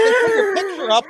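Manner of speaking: high, loud shouted quote; mock outraged shout; retold shout. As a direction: yell it like the upset neighbour. Neighbour yelling about the posters.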